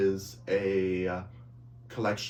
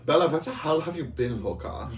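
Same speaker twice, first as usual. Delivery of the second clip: camp it up as a German Twilight character; teen alter ego shouting to Bella in a mock accent; 7 semitones higher than usual.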